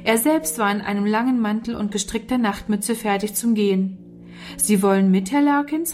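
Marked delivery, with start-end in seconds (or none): none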